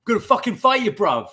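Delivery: high, loud London lad threat; real British fight talk. Retold or imitated. Showing how a Brit really talks in a fight, high and loud London accent. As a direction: snarl it like a pub brawler. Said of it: "It's gonna be".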